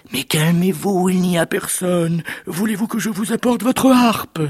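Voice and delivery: high-pitched, pleading wife voice; placating wife. Acted. Giant's wife calms her raging husband, high placating voice. Wife soothes the angry ogre.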